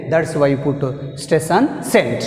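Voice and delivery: ordinary talk, nothing marked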